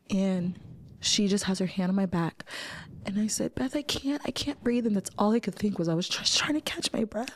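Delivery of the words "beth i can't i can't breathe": breathless plea. Retold or imitated. Panic attack, can't breathe.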